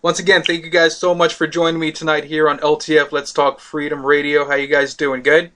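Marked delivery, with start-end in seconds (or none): none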